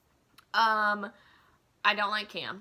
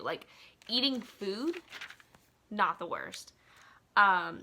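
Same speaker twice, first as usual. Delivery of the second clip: normal talking voice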